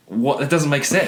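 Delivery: high, baffled disbelief. Retold or imitated. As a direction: sound totally confused. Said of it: "and I'm like".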